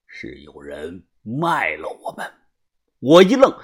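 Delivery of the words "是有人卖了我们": low, slow, quiet accusation; ice-cold. A narrator's reading. Boss tells the group of a traitor, icy voice. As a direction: state it coldly, with measured menace. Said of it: "把头声音冰冷地说".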